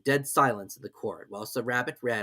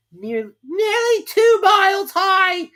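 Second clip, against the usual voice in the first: very high, slow, loud queen voice; queen correcting Alice; 11 dB louder, much slower than usual. A narrator's reading. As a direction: drawl it out as the haughty queen. The queen adds.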